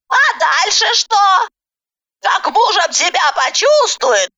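put-on high, emphatic old woman's voice; snide warning granny. A narrator's reading. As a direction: scold and warn in a high granny voice. Granny character warns. (ехидная бабка поучает)

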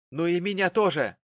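loud, high comeback; heated father's rejoinder; angry retort. Acted. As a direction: fire it back in anger. Quarrel, father retorts angrily.

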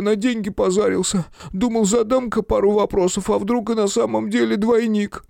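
high, pleading voice of a frightened man; nervous photographer voice. A narrator's reading. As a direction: beg and babble like a scared little man.

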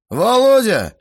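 loud, high-pitched summons; stern call. A narrator's reading. Guest orders the cheeky teenager over, loud and commanding. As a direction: call the boy over sharply and loudly. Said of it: "окликнул меня Грохотов-старший".

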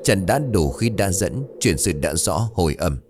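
plain reading voice

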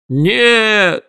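high, drawn-out cry of refusal; one long high-pitched howl; anguished yell. A narrator's reading. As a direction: wail it out high. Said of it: "завопил мистер Мрак".

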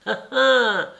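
put-on high, pestering old man repeating hein; nagging litany. A narrator's reading. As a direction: needle him over and over in a high voice. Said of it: "le lardait tout vif d'une obsession de litanie".

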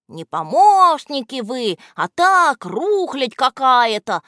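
slow, loud and high furious roar; angry bark. A narrator's reading. Кляксич berating his helpers, slow and loud. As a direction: bark at them as useless junk. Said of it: "рявкнул на него Кляксич".

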